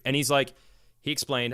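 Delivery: normal talking voice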